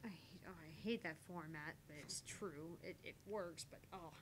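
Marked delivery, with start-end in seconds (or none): none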